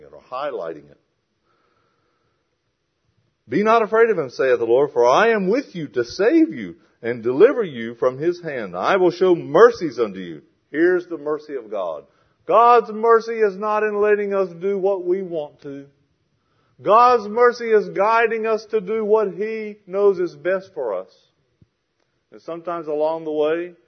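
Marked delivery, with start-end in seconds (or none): 3.5-10.4 high, loud declamatory verse reading; scripture reading. Acted. Preacher reads God's promise of mercy, loudly declaimed scripture. 12.5-21.1 high, loud, emphatic pulpit delivery; forceful preaching. Real, not acted. Preacher explains what God's mercy really is, impassioned preaching.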